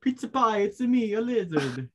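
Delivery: hammed-up Italian voice, pitched high; mock Italian accent. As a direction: play a cartoon Italian, over the top. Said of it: "You Italian, what do you say?".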